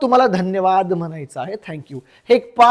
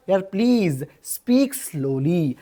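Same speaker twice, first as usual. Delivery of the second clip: slow, low, softer request; slow teaching model; 5 semitones lower, 5 dB quieter, slower than usual. Shown as a demonstration. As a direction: stretch the words out slowly. Teacher models asking to speak slowly. (सावकाश, हळू आवाजात)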